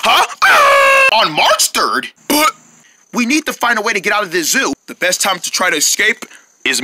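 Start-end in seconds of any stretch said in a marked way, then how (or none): 0.4-1.2 cartoon scream.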